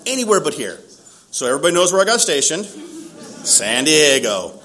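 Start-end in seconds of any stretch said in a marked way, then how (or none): 2.7-4.6 very slow, stretched out San Diego; slow "San Diego", each syllable stretched; drawn out. Shown as a demonstration. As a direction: drag out "San Diego" in dismay. Disbelief at station.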